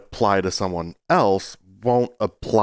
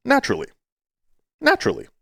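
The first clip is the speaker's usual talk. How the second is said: mock high exclaim; much faster than usual. Dismissive repeated word.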